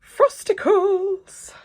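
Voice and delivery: high, loud, drawn-out cry; excited squealing announcement. Real, not acted. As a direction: shout it out high with glee. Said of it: "Sorry, I get excited".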